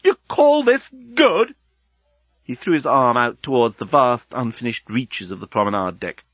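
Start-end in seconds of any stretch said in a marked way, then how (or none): 0.1-1.5 much higher, slow outraged cry; yelled. Acted. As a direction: fling the question at him. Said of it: "yelled Leovinus".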